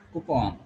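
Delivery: coupon with an O in the last syllable, koo-PON; teacher modelling coupon with a clear O; correct pronunciation. Shown as a demonstration. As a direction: say coupon as "koo-pawn", open O. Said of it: "There is O sound here".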